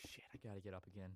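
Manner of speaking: low, soft muttered dread after a sigh; sigh, then grumble quietly to yourself; dejected private aside; weary resignation. Retold or imitated. Retold reluctant thought after sighing.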